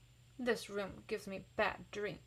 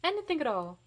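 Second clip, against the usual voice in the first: raised, bright teacher encouragement; 7 semitones higher, 4 dB louder than usual. A narrator's reading. Teacher to her class, a touch higher and cheery. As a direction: coax the kids along. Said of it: "our teacher says".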